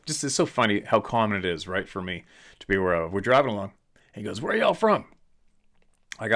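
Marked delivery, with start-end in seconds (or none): 4.4-5.1 put-on high, curious old driver asking; cab driver's voice. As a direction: pitch up into the cabbie's friendly question.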